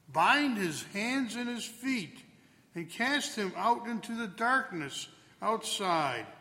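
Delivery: normal talking voice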